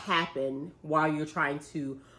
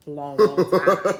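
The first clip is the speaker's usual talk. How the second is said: slow, laughing long long time; drawn-out and giggly; laughing drawl; 8 dB louder, slower than usual. Retold or imitated. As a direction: stretch it out through a laugh. Daughter's drawn-out words, laughing.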